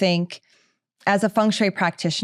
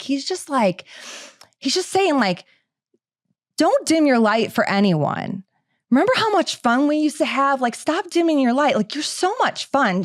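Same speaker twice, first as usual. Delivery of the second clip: higher, eager channeling voice; imitating gushing psychic; 7 semitones higher than usual. Medium relaying Steve's message, higher excited voice. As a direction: pass on the spirit's message brightly. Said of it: "She's like".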